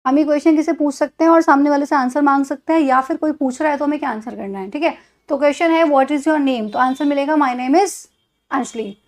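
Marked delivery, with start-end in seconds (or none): none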